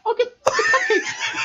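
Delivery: squealing with laughter, high and eager; overexcited. Acted. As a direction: burst out giddy and excited. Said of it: "I got a little too excited".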